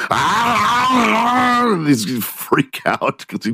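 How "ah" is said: startled yelp. Retold or imitated. Arnold freaking out.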